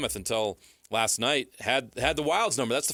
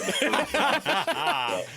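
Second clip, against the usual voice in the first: high laugh; 13 semitones higher than usual. Real, not acted. Laughs at Hofstra pick.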